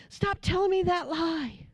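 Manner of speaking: high, exasperated retold protest; imitating upset daughter. Daughter rejects mother calling her beautiful, mock exasperated.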